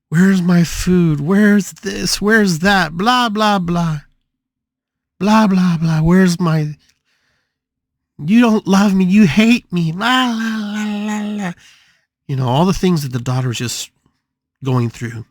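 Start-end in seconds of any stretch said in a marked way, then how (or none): 0.1-11.5 high, loud mock tantrum, drawn out; imitated yelling and screaming. Bedridden daughter demands food from her mother, mock screaming. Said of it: "yelling and screaming".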